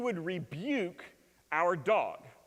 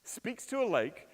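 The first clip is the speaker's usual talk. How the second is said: ordinary delivery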